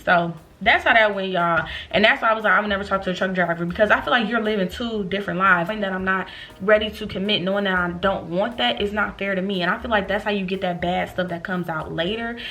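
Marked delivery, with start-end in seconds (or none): none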